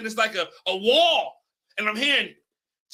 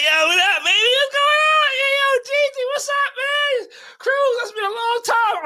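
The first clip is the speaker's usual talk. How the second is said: excited greeting; 13 semitones higher, 4 dB louder than usual. Retold or imitated. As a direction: holler out a thrilled greeting to old friends. Acted happy greeting across the room.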